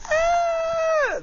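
high squeal of joy; delighted squeal. Retold or imitated. Young woman spotting her friend in the food court, squealing.

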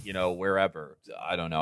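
usual speaking voice

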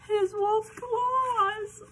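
high, loud, stretched-out wail; excited mock alarm. Reader pretends to children she has found wolf claws, excited cry.

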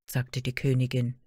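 ordinary delivery, nothing marked

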